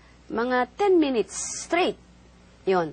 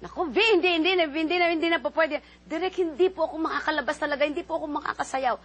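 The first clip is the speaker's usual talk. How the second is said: higher, fast, insistent no; anxious refusal; 6 semitones higher, faster than usual. Retold or imitated. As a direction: refuse the director in a rush. Retold refusal to director.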